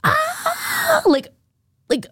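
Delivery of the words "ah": short, very high, shrill shriek, imitated; imitated scream. Retelling the hotel night, imitating the neighbour's high scream. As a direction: mimic a woman's scream through the wall. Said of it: "I'm talking screaming".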